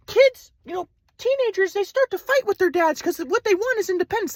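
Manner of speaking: high, airy-calm imitated grandpa; spiritual grandpa. Grandpa from Kauai lecturing Ryan, high serene mock voice. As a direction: preach oneness like a serene guru.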